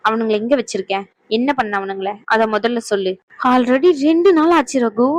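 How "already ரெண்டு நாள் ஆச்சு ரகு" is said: usual speaking voice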